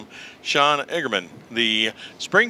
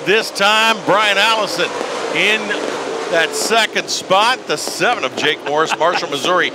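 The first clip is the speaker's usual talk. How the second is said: higher, lively lap-by-lap race call; motorsport commentator; 6 semitones higher than usual. Acted. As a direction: call the leaders coming around. Live race play-by-play call.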